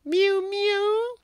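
very high cat mew; cat crying mew. A narrator's reading. Simpkin cries at the door, a high loud mew. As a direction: mew loudly like a shut-out cat. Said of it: "cried Simpkin".

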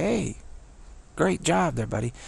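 high-pitched mock praise; hostile mockery. Driver imitating another motorist's thumbs up, hostile mock praise. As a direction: imitate a jab dressed up as friendliness. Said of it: "that was a hostile thing".

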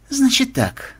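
high, musing start; thoughtful. Acted. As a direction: begin as if thinking it over. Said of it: "задумчиво проговорил Ксенобайт".

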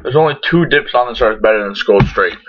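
ordinary talk, nothing marked

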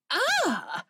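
short high shriek of horror; a sudden shocked shriek. Acted. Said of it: "Aunt Trixie shrieked again".

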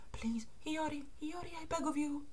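high, very soft begging; hushed pleading. Acted. Begging Hiyori, barely audible and high.

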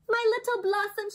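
high, loud, delighted grandma calling her grandchild; grandmother crying out happily. A narrator's reading. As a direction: greet her like a beloved grandma, overjoyed. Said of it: "she cried".

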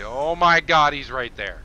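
sudden loud outburst of shock; startled alarm. Real, not acted. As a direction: blurt it out loudly, caught off guard. Suddenly spots submarine very close.

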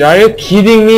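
loud incredulous outburst; exasperated disbelief. Real, not acted. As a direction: yell at the screen. Game springs unwanted surprise.

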